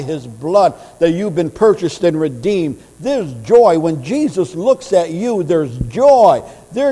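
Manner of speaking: loud, high, fervent preaching; preaching at full force. Acted. Preacher proclaiming Christ's joy to the congregation, fervent and loud. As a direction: lift the congregation with rising joy.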